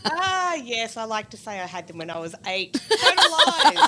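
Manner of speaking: laughing. Real, not acted. Guest jokes about her age.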